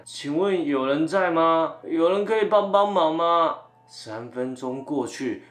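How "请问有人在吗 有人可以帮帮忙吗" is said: calling out. Retold or imitated. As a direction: call for help from the doorway. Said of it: "我就只能在门口喊".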